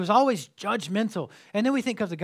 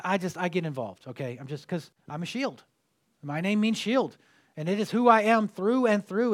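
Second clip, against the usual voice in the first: usual speaking voice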